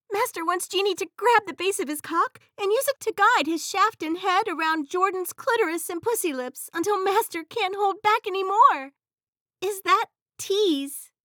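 high, loud eager genie; eager innocent servant, high up. Acted. Genie asks master.

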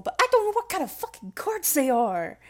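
choked-up disbelief at "fucking chords"; tearful exasperation. Real, not acted. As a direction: blurt it out, half crying and baffled. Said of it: "I'm sorry to get all emotional".

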